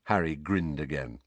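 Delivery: plain reading voice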